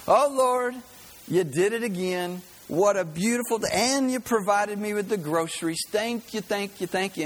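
high, fervent thank-you; joyful grandma praise. Retold or imitated. Grandma finding groceries on porch, high joyful praise.

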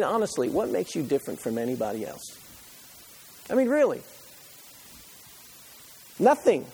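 usual speaking voice

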